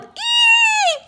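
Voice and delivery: shrill high scream of a crowd. A narrator's reading. Crowd screaming at the shark, a high terrified ee. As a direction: squeal in terror. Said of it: "screamed everybody else".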